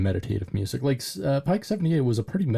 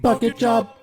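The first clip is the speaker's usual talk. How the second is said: high, loud goofy falsetto; silly squeal; 13 semitones higher, 5 dB louder than usual. Pretend. Riffing with co-hosts on a joke, silly squeal.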